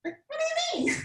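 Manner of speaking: extremely high, soft squeak; puzzled student in a tiny cutesy voice; Lisa. Retold or imitated. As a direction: imitate a squeaky, baffled student. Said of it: "And Lisa talks like this".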